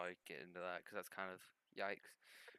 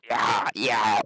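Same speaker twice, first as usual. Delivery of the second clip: loud distorted yelled yeah; [name] impression; 22 dB louder than usual. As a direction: belt it like a distorted song ad-lib. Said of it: "That's my Kids See Ghost impression".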